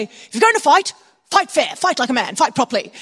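lightly voiced British schoolmaster; British accent. Master instructing boys before boxing, British accent. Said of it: "I'm doing a British accent".

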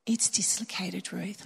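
slow, soft, soothing nurse; calm her down gently and slowly; patient and calm. Retold or imitated. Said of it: "walked up to me so patiently and goes".

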